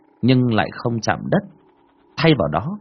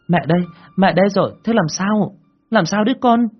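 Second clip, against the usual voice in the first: put-on high, fast worried mother soothing a crying baby; anxious mother; 12 semitones higher, 4 dB louder than usual. A narrator's reading. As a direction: rush in, pitched up, fretting over the baby.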